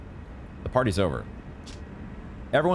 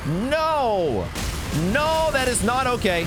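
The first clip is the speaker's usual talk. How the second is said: high, slow, stretched-out disbelief; outraged disbelief; 12 semitones higher, slower than usual. Real, not acted. Gamer shocked the boss summons revenants, drawn-out outraged disbelief. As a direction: draw out the outrage like you can't believe it.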